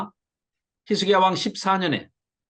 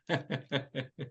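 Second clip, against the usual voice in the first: short, soft scornful chuckle; a quiet sneering laugh at the defenders; mocking laugh; 5 semitones lower, 12 dB quieter than usual. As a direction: laugh at the enemy's offer with scorn. Mocking enemy's offer, preacher laughs.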